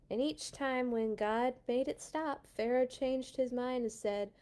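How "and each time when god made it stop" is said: usual speaking voice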